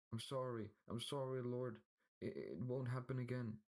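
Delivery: high, fast pleading servant; begging. A narrator's reading. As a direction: plead for mercy. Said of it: "stuttering man".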